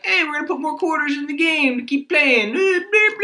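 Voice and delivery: high, goofy put-on voice; clueless gamer voice. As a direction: play a clueless dude who doesn't get games. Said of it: "the one where you're like".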